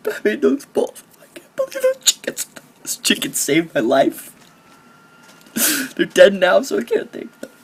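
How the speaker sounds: high, loud, hammed-up weeping; mock emotional. Gamer mourns the chickens that saved his life, mock sobbing. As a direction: break into squeaky fake sobs of gratitude. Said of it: "Sorry, I get emotional".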